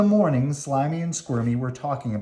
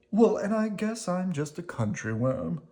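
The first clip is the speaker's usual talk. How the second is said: gently raised worm voice; lightly voiced Squirmy; 6 semitones higher than usual. Squirmy replies to Slimy, in a lightly raised gentle voice. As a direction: answer back kindly.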